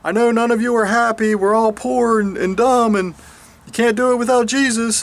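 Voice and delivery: mock the preacher's gloomy sermon. Preacher telling the congregation they're weak, lightly mocking. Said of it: "the preacher's up there preaching".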